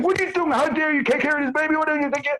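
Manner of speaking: very high, shrill scolding granny voice; mock yell. Old lady scolding a mother near Bellevue, high mock yell. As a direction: squeal it out like a meddling old lady. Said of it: "old lady is yelling at this mother and saying".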